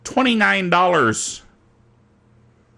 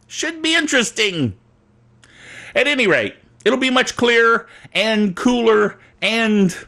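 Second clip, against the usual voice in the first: usual speaking voice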